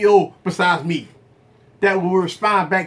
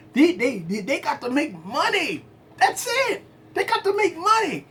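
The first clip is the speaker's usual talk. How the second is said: mock high voice; 7 semitones higher, 4 dB quieter than usual. Mocking money-driven stockholders.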